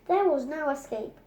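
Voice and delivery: ordinary delivery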